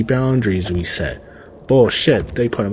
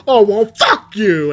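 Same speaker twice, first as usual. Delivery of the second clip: mock comeback; 9 semitones higher, 4 dB louder than usual. Imagined comeback to brother.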